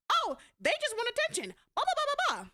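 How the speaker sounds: high-pitched dismissive parody; mocking mimicry. Daughter mimicking mother dismissing her child, high-pitched mockery. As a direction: chatter it in a silly high mocking voice.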